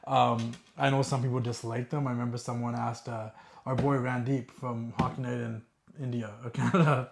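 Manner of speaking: ordinary delivery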